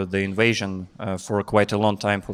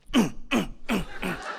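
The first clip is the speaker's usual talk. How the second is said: coughing demo; 7 semitones higher, 7 dB quieter than usual. Shown as a demonstration. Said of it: "which is coughing".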